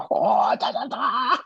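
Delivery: very high, mock Japanese voice acting; terrible Japanese impression. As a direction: badly fake an excited Japanese unboxer. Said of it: "voice acting is absolutely terrible".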